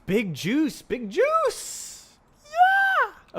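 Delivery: very high, swooping cheer; silly hyped squeal. Pretend. Coder hyping up the big juice, silly squealed cheer. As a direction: shriek it in mock hype, climbing to a squeal.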